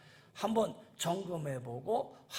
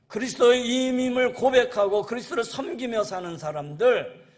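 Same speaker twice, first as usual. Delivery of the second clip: loud, higher, fervent pulpit voice; full-force preaching; 4 semitones higher, 10 dB louder than usual. Real, not acted. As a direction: proclaim it out over the congregation.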